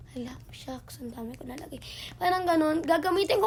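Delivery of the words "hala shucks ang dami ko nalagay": whisper. Retold or imitated. Whispering own reaction to glue.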